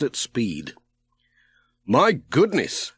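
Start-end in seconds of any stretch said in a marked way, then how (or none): 1.9-2.9 slow, higher, mildly amazed; a gentle wow at the speeding boat; Pat impressed. A narrator's reading. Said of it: "said Pat".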